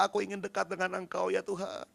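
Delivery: tired whispered-down prayer to God; quiet prayer. Acted. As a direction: pray it close, fading to almost nothing. Quoting intimate prayer.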